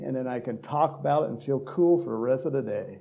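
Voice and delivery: ordinary talk, nothing marked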